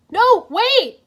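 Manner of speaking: high, loud urgent call; shout. A narrator's reading. Cole shouts to stop the fleeing hunters, loud urgent call. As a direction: shout to stop them running. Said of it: "Cole shouted".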